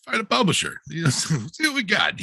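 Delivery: quick, upbeat imitation of her younger self; eager past self. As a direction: rattle it off like a hopeful young writer. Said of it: "I was like".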